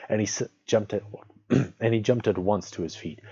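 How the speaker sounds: usual speaking voice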